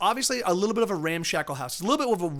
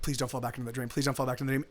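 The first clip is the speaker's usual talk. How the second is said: hushed plea; 5 semitones lower, 6 dB quieter, faster than usual. Retold or imitated. Pleading with himself at night.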